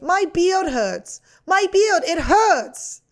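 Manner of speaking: put-on high, slow toddler whine; whiny toddler. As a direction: whine it like a hurt little kid. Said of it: "this is my impression of a toddler".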